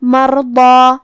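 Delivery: level chant of مرضی, thick ḍad carried into the held vowel; heavy tafkheem. Shown as a demonstration. Teacher demonstrating heavy letters, full-mouthed tajweed recitation. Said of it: "تفخیم سے مطلب بھاری آواز میں".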